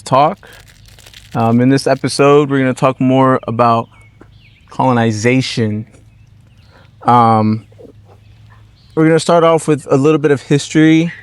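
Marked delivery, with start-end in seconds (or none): none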